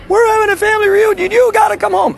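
mock hysterical mother, very high and fast, half crying; bellering mother. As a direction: shriek it like a sobbing, screaming mom. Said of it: "beller like this".

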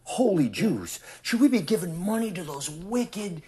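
high-pitched, indignant mimicry of pious objectors; put-on high voice of scandalized complainers; mock indignation. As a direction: voice their outraged question, pitched well up.

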